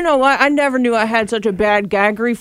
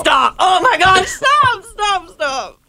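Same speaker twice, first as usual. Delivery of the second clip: shouting; 8 semitones higher, much slower than usual. Real, not acted. Friend gags, disgusted pleading.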